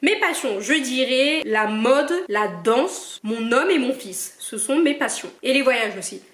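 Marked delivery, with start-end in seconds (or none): none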